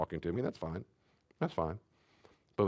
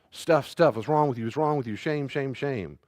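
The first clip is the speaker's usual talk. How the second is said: mock shaming parent; 5 semitones higher, 4 dB louder than usual.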